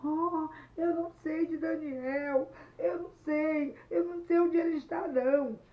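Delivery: acted high, loud wail; tearful crying voice. Cornered Luiz answers Anete, wailing tearfully. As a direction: wail the denial like a crying boy. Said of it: "E Luiz, chorando, disse".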